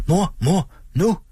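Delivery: put-on high, eager child voices; children calling. A narrator's reading. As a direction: call mother excitedly. Children calling their mother. (børn kalder ivrigt på mor)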